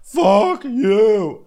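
high, loud spat curse; mock angry. Friend mimicking the rant, high and loud. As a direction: hurl it in a high mock fury. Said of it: "And she goes".

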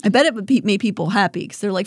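normal talking voice

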